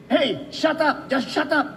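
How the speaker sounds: high sharp yell of shut up; shouting Filipina wives. Retold or imitated. Filipina wives yelling at English fans to shut up. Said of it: "yung mga Pinay".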